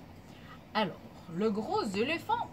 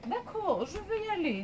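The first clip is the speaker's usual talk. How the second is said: higher mum voice, agreeing; mama monkey; 8 semitones higher than usual. Acted. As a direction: agree in a high, lilting mum's voice. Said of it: "dit maman singe". (voix haute de maman singe)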